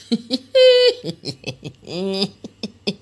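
far-off cheeky laugh; mocking giggle. The friends mocking Marion, giggling. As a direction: chuckle at someone's bad luck. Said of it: "elles ont ri".

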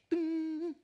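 imitated phone chime, very high; message ding. Phone buzzes with a message, high chime noise. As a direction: squeak out the ding of a phone alert.

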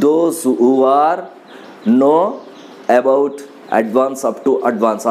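very slow English words with long gaps between them. Shown as a demonstration. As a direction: drag each English word out for learners.

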